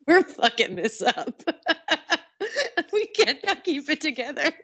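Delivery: laughing. Real, not acted. Giggling fit mid-podcast.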